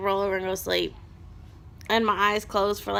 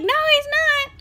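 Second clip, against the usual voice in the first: very high, loud, frantic denial; high-pitched mom panic; panicked denial; 9 dB louder than usual. Retold or imitated. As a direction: insist he's wrong in alarm. Panicked contradiction of husband.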